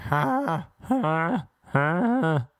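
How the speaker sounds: three separate high "ha"s; imitated odd laugh. Girlfriend laughing, imitated in high separate bursts. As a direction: mimic a strange, high laugh. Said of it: "she laughs like".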